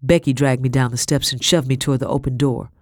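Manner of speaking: ordinary delivery, nothing marked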